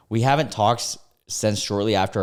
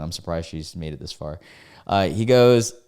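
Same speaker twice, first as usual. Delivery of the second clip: ordinary delivery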